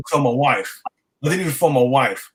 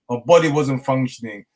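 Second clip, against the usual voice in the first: ordinary delivery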